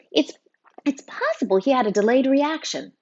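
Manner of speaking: high, fluttery, anxious teacher voice; flustered teacher. A narrator's reading. Teacher character.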